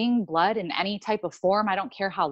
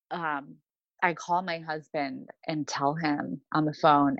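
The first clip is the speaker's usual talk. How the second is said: ordinary delivery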